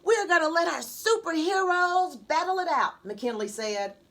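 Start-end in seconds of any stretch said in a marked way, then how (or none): 0.0-3.0 a lightly voiced eager boy, higher; a kid bragging about superheroes; excited little boy.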